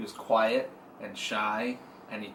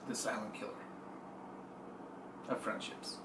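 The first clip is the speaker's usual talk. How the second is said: mock ominous; 4 semitones lower, 17 dB quieter than usual. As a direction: intone it like a doom trailer. Mock dramatic topic announcement.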